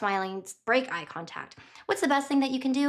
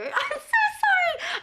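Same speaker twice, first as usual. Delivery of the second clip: laughing; slower than usual. Real, not acted. Laughs while apologizing.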